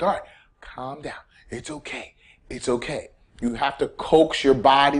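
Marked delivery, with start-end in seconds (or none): 0.0-3.1 put-on much softer, hushed soothing to a scared kid; calming a child. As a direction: settle the kid down gently. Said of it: "You're not gonna do that".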